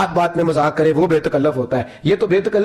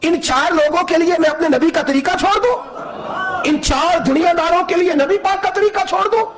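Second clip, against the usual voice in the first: raised, angry rebuke, near a shout; stern rebuke; 10 semitones higher than usual. Acted. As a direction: thunder at him, offended. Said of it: "ماتھے پہ شکن آیا".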